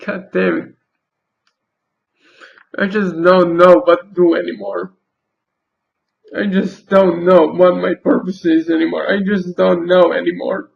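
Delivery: apologizing while tears take over; openly crying. Real, not acted. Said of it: "I'm sorry I'm crying".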